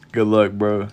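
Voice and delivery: ordinary talk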